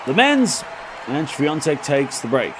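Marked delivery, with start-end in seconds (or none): 0.1-0.6 excited play-by-play call. Real, not acted. Live call as point is lost.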